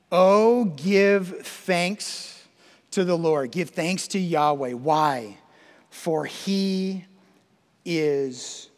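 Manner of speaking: slow, word-by-word scripture call with long pauses; psalm proclamation. Real, not acted. Preacher calls the church to give thanks, slow proclamation. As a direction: proclaim it, drawing out each phrase.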